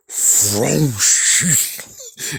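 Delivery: loud mouth-made vroom and whoosh; imitated car roar. Batmobile answers the Penguin's boast, loud imitated roar.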